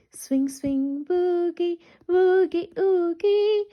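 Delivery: ordinary talk, nothing marked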